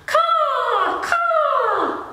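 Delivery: high cawing crow call; crow caws. Shown as a demonstration. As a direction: caw like a crow. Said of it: "Can you sing some bird sounds with me?".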